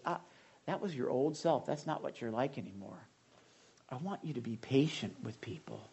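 calm, tender correction; Holy Spirit speaking. Acted. Preacher voicing the Holy Spirit to believers, soft and gentle. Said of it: "The Holy Spirit says".

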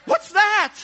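hammed-up surprised question; mock startled question. Preacher shows a reaction the disciples did not have, mock startled. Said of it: "or like".

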